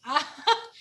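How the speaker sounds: brief amused burst of laughter; quick laugh at catching them out. Real, not acted. Amused at parents' mistake.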